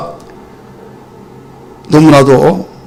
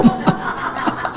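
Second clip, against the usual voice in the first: airy laugh of relief; breathy joyful laugh; 12 dB quieter than usual. Acted. General laughing after being healed, breathy laugh. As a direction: let out a delighted airy laugh.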